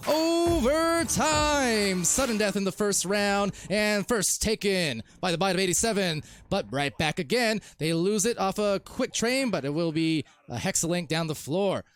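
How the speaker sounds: high, stretched announcer kickoff into lively commentary; excited sports caster; overtime play-by-play. Acted. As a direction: draw out the big announcement, then race on. Live play-by-play.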